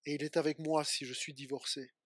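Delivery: low, soft answering line; subdued affirmation. Acted. Preached contrast, bad times. (affirmation grave, à voix basse)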